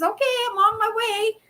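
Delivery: high put-on lady voice; mock eager woman on her way; imitating the lady. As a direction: chirp it like the buyer hurrying over. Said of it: "She says".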